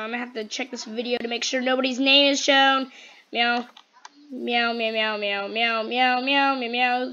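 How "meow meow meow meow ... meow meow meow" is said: loud chain of meows into the mic; insistent meowing. Pretend. Player keeping others from being heard, insistent meowing. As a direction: meow nonstop to drown people out. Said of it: "Just screaming this in the mic".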